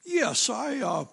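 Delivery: a hint of an Oxford-accented king reminiscing; posh English king.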